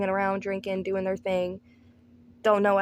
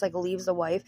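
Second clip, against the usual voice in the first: ordinary talk, nothing marked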